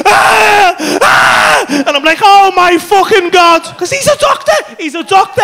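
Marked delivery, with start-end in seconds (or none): 0.1-1.7 high, loud mock scream; imitated screaming. Comedian imitating his friend up on his shoulders, loud screaming. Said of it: "stop screaming, for fuck's sake". 2.2-5.4 high, loud exclamation of disbelief; retold panic. Comedian re-living his doctor friend's bad trip, panicked disbelief.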